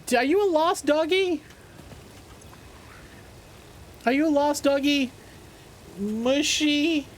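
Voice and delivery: high, cooing pet voice; high-pitched talk to a stray pet; doggy talk. Real, not acted. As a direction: coo up high to a lost little dog. Talking to a lost doggy.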